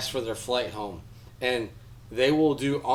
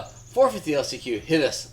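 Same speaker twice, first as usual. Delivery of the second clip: usual speaking voice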